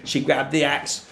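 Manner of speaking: plain reading voice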